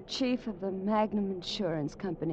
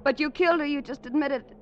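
louder, higher accusation; accusing cry; 8 semitones higher, 5 dB louder than usual. Acted. Marion to Joe after his confession, accusing cry. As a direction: throw the killing back at him.

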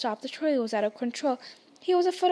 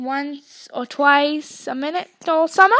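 sarcastic; 2 semitones higher, 8 dB louder than usual. A narrator's reading. Twin teasing.

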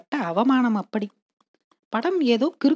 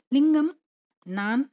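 plain reading voice

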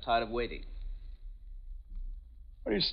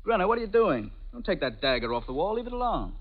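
alarmed; 7 semitones higher than usual. Acted. Sees her take dagger.

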